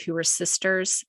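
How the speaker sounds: usual speaking voice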